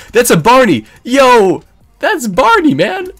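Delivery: high, loud, rising excited yell; excited shout. Real, not acted. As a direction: shriek with gamer hype at spotting him. Excited spotting Barney in fight.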